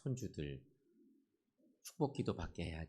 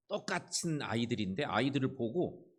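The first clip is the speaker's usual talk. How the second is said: normal talking voice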